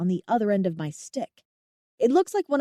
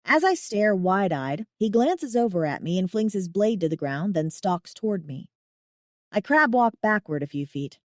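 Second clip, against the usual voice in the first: plain reading voice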